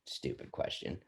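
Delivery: half-laughed, near-whispered scoff; laughing whisper at a silly question. Retold or imitated. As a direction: scoff it out through a laugh, almost unvoiced. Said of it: "And I'd be like".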